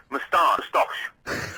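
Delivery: "Moustache" slow, breathy, with a stretched second syllable; father's odd moustache. Retold or imitated. Imitating the father saying "moustache" slowly. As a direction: draw out "moustache" oddly, like a father. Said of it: "He also used to say".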